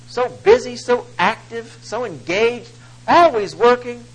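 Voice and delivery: a hint of a churchgoer's gushing praise; admiring onlookers. Community observers praise the brother, mildly mocking admiration. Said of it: "we would look at this older brother and we would say".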